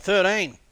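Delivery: "Thirteen" said loud and high with a falling tune; repeating thirteen. Retold or imitated. [name] repeating his Aussie word back to them, loud and high. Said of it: "I'm like".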